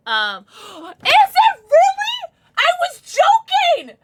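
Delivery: very high, loud, slow gasping disbelief; stunned that the joke guess was right; shocked disbelief. Real, not acted. Streamer learning her joke guess was right, shocked.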